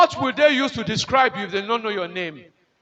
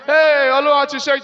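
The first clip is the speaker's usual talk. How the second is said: shouting; 3 semitones higher, 5 dB louder than usual. Retold or imitated. Friends shouting at delivery ward.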